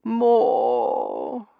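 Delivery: loud, high drawn-out miaow; imitated cat cry; cat's meow. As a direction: meow like a cat wanting attention. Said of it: "she said, and rubbed her nose".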